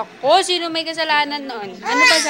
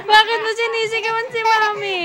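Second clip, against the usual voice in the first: high-pitched mock complaint to baby; playful scolding; 7 semitones higher, 4 dB louder than usual. Parent asks shouting baby why, in playful scolding.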